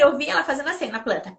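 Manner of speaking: ordinary talk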